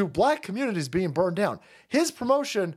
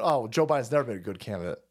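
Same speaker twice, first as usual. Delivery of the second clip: mocking the host; 7 semitones lower, faster than usual. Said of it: "Now he's sitting there going".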